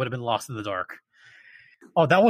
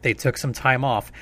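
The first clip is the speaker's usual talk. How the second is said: louder announcer sponsor pitch; ad read; 3 dB louder than usual. Acted. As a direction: sell it like a host ad.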